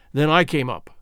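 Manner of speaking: ordinary delivery, nothing marked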